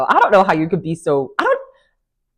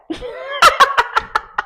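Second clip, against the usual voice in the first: high burst of laughter. Real, not acted. Cracking up at the cat line.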